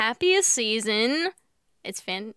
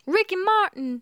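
Southern belle; 8 semitones higher than usual. Acted. Host teasing co-host about the name, Southern belle accent. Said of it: "a nice little Southern belle".

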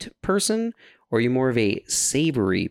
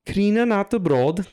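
mock Swedish; 11 semitones higher than usual. Shown as a demonstration. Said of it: "I know a little bit of Swedish".

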